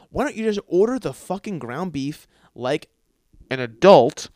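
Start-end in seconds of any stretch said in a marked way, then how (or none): 3.5-4.3 much louder punch on adult; comic loud jab in a rant. Acted. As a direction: land the insult hard. Ranting at the mom.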